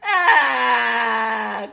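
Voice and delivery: a burst of crying; the fairy bursting into loud tears; loud sobbing wail. Acted. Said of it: "cried the Tooth Fairy".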